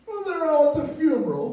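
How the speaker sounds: put-on high, loud, blubbering old man; old man still crying delivers the punchline, loud mock wail; tearful punchline wail. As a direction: sob out the punchline.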